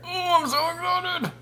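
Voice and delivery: a high, slow, sing-song squeal of delight; gushing over the plate of biscuits and gravy; gushing excitement. Real, not acted. About to taste the food.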